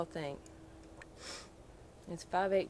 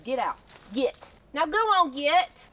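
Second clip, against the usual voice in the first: loud, higher shoo; 7 semitones higher, 7 dB louder than usual. Real, not acted. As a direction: chase it off. Shooing armadillo.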